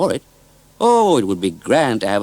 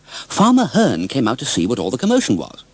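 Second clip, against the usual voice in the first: ordinary delivery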